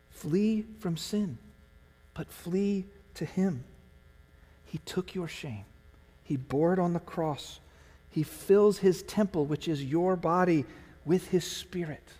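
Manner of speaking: high, slow pleading appeal; slow, earnest preaching. Acted. Pastor urging strugglers to flee to Jesus, slow preaching. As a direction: draw each phrase out to move them.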